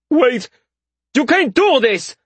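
high-pitched soldier's cry of alarm; panicked protest. A narrator's reading. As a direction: plead urgently, voice shooting up high. Soldiers protest as spell begins.

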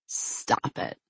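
unvoiced sharp hiss. A narrator's reading. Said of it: "Theo hissed".

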